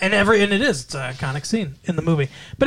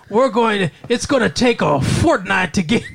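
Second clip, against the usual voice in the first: higher-pitched, badly faked Texan twang; bad Texan accent; 4 semitones higher than usual. Imitating the film's terrible American accents, bad Texan accent. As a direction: do a clumsy Texan accent, breaking into laughter. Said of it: "it was kinda like a Texan".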